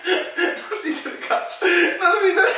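high laughing half-shout; laughing cry. Real, not acted. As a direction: yelp it through laughter. Balance failing, both laughing.

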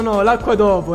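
normal talking voice

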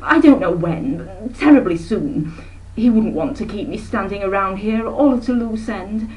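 high, bright-voiced busy aunt rattling on; a breezy society lady who never stops talking; breezy chattering aunt. Aunt Emma chatters to her sister, airy and high.

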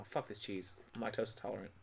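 ordinary talk

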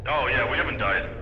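ordinary talk, nothing marked

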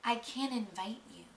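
soft, sugary fake-sorry whisper; fake sympathetic hush. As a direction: pretend to be kind while being cruel. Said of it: "I was like".

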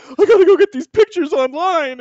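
high, loud hurried announcement; loud excited quote. Retold or imitated. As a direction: blurt it out over the noise. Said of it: "And my statement is".

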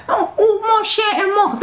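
high mocking whine; mocking whiny classmate. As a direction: sneer at her fake grief in French. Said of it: "I ain't gonna tell them in French".

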